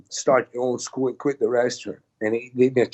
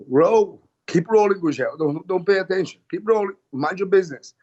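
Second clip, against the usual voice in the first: coach calling out; 6 semitones higher than usual. Retold or imitated. Hanzo calls to his student on the mat, higher and urgent. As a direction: urge the student to keep rolling.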